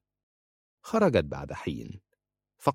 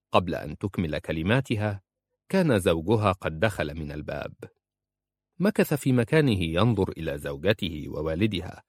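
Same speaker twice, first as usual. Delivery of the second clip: ordinary delivery, nothing marked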